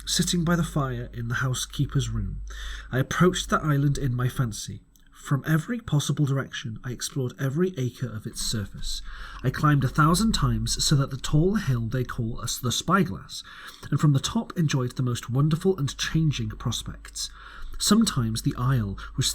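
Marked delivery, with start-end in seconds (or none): none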